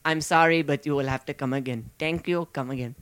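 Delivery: a hint of a bad Indian accent, mock shopkeeper; lightly put-on Indian accent, refusing a customer; terrible Indian accent. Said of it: "that's a very terrible Indian accent".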